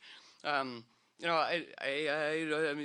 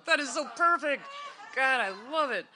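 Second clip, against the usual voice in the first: much higher, loud gush of delight; delighted exclaim; 9 semitones higher, 8 dB louder than usual. Retold or imitated. Reacting to Snowden's Sabian symbol, high loud delight. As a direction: squeal it like you just found treasure. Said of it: "And I go".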